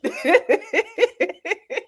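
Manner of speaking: long high giggle, trailing on; laughing. Real, not acted. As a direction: crack up at your own joke. Laughing at own state joke.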